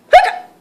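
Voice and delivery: sudden high squeaky hiccup; loud hiccup. Acted. Girl with hiccups lets out a loud high hiccup.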